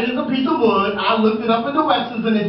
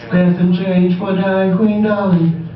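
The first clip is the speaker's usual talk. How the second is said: begging queen. Acted. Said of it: "and I say".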